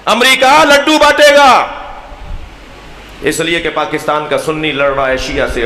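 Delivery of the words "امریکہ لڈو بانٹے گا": loud, high, drawn-out climax; ringing pulpit shout, stretched out; rousing proclamation. Real, not acted. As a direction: cry it out slowly to the crowd. Climax of sermon's warning list.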